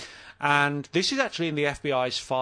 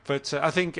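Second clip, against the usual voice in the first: ordinary talk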